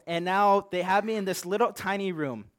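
ordinary delivery

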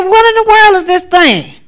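high, loud startled question; alarmed cry. Retold or imitated. Dreamer facing an unknown thing, high and loud.

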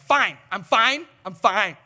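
loud, slow, forced "fine, I'm fine"; loud insistence. Retold or imitated. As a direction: insist too hard that you're fine. Grieving family insisting they're fine.

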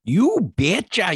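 put-on slow, drawn-out Minnesota accent; hammy Minnesota accent, a bit slow. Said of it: "Minnesota accent".

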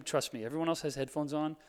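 ordinary talk

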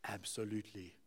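put-on low, quiet South African accent; deadpan South African "absolutely". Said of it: "with the South African accent".

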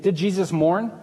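ordinary talk

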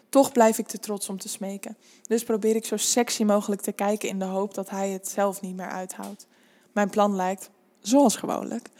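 ordinary delivery

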